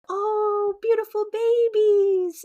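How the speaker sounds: high, slow cooing over babies; admiring passerby. Retold or imitated. Passerby admiring the twins in the stroller, high slow coo.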